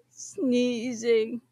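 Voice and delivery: ordinary delivery, nothing marked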